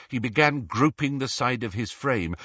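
ordinary delivery, nothing marked

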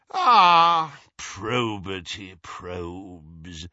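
drawn-out, slow theatrical sigh. Acted. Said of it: "sighed Travers theatrically".

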